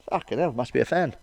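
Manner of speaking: ordinary talk, nothing marked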